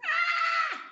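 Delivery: very high, loud mock shriek; childlike scream. Shown as a demonstration. Preacher shows men scream like children, high mock scream. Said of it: "gritan como niños también".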